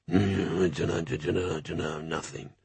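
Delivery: low, soft gnoll mumble. A narrator's reading. Gnoll dodging the officer's question, low mumble. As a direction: trail down into an evasive creature mumble.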